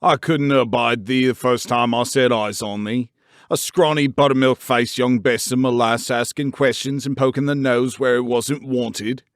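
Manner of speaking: loud, gruff Yorkshire rant; indignant old man ranting down at a girl; haranguing old gardener. A narrator's reading. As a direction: scold her from the ladder. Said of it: "he harangued".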